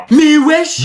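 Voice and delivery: high, loud disbelieving wesh; outraged wesh. Acted. Pupil reacting to the class, loud high outraged yelp. Catchphrase yelled.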